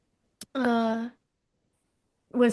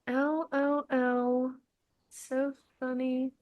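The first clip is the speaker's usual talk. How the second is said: deadpan reading; 2 semitones higher, 7 dB quieter, slower than usual. Pretend.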